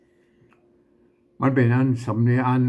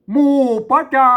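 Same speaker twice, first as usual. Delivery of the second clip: reading aloud; 12 semitones higher, 7 dB louder than usual. Shown as a demonstration. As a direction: recite it high and loud like reading the verse aloud. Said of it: "หมู่ปะจา (ซ้ำแบบพูดปกติ)". (อ่านออกเสียงแบบท่องกลอน เสียงสูงและดัง)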